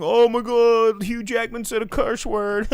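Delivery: high, loud, hammed-up glee ending in laughter; exaggerated high-pitched crowd laughing at naughtiness; mock laughing audience. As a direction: imitate a delighted moviegoer, then cackle. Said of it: "like, laughing, going".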